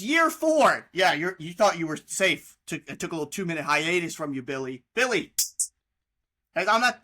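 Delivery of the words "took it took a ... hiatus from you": usual speaking voice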